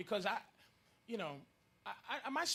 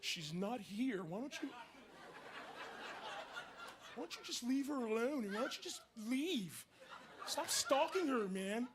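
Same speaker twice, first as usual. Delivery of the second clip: defensive egg; 2 semitones higher than usual. Acted.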